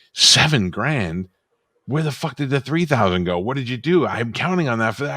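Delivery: mock outrage over missing money; imitating angry wife. Retelling his wife's outrage about the cash, mock angry. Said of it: "And she was like".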